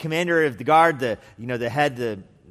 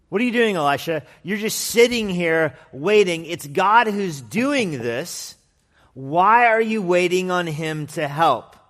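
loud, higher, slow scorn; scornful complaint; 5 semitones higher, 6 dB louder, slower than usual. Acted. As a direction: needle Elisha with drawn-out scorn. Leaders mock Elisha's waiting.

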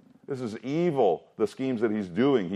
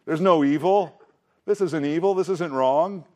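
mock innocent denial; 6 semitones higher than usual. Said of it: "The meme, right?".

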